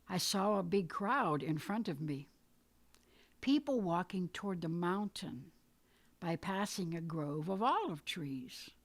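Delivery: ordinary delivery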